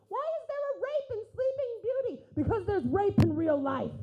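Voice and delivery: incredulous rant. Real, not acted. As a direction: push it out as a loud outraged rant. Ranting joke punchline.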